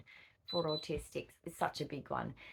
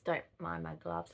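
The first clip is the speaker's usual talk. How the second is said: ordinary talk, nothing marked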